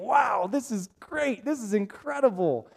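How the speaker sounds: a touch of hammed-up thrill; excited. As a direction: gush with mock excitement. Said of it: "it's exciting".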